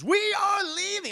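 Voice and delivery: high, loud military order; Captain Bryce. Retold or imitated. Streamer quoting an iconic film line, high shouted command. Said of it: "Captain Bryce".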